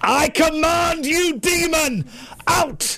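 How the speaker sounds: high, drawn-out exorcist's command; hammed-up preacher ordering a demon out; exorcism re-enactment. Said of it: "He's doing this".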